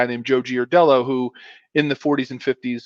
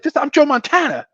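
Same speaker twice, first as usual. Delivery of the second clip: high, playful mock indignation; Joe Montana; 12 semitones higher than usual. Star quarterback kidding a fan's dad, mock indignant. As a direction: protest with pretend offence, pitched way up.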